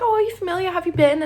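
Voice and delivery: high, loud imitation of a keen guest; eager interest. Guest asking if she knows Australia, imitated eager interest. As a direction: act out his excited curiosity.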